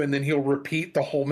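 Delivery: ordinary talk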